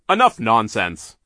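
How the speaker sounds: Mister Poe silencing Olaf, stern; stern banker. Acted. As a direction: cut them off firmly.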